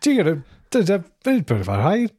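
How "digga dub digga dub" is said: lightly voiced seventies robot; chirpy little robot babble; cute robot sidekick voice. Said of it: "adorable seventies robot sidekick".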